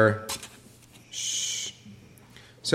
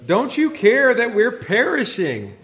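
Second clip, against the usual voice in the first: high, slow, wailing plea; panicked disciples; 10 semitones higher, 4 dB louder, slower than usual. Retold or imitated. Disciples crying to the sleeping Jesus in panic. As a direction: cry out like men about to drown. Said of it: "they cry out to him".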